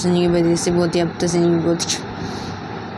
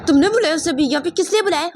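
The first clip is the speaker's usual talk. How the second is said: high, quick, accusing demand; angry questioning; 11 semitones higher, 3 dB louder than usual. Acted. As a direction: confront the daughter in a sharply raised pitch. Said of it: "गुस्से से देखते हैं". (गुस्से में ऊँची आवाज़ में तेज़ सवाल)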